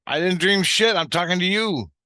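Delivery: high, insistent objection; acted-out exasperated comeback; indignant protest. Retold protest in an argument.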